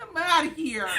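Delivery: high, loud whiny kid impression; little boy. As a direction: squeal it like a sulky eight-year-old, amid laughter. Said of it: "like, a little Caucasian eight-year-old boy".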